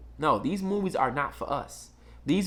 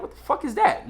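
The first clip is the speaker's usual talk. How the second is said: shocked; 9 semitones higher than usual. Retold or imitated. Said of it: "I was like".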